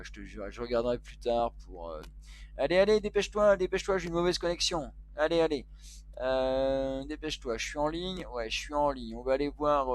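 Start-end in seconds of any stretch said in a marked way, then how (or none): 2.6-7.6 fast, loud, higher-pitched hurrying; impatient hurry-up at a lagging connection; urging it on. Real, not acted. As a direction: rush it out impatiently, voice raised. Urging a slow connection to load.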